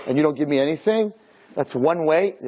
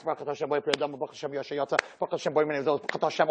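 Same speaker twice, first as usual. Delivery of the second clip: parroted prayer; 8 dB quieter, faster than usual. Acted. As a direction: mumble the blessings mechanically, like a parrot. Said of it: "we go to shul and we're parrots".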